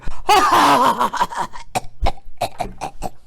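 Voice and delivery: hammed-up evil cackle; maniacal laugh. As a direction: cackle like a cartoon villain. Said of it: "Insert maniacal laugh here".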